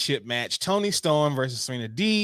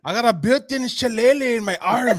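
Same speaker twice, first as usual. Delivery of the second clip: higher put-on Irish brag through laughter; laughing mock Irish accent; 7 semitones higher, 4 dB louder than usual. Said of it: "This accent, though".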